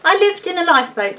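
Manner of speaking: a lightly voiced bear announcing. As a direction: declare it proudly like a little bear. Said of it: "he announced".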